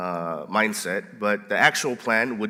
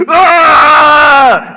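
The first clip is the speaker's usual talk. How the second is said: loud, high, drawn-out yell; long scream; 15 dB louder than usual. Acted. Pastor acting his plan to scare the kids, long loud scream. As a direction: scream full out to freak someone out. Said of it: "run downstairs and go".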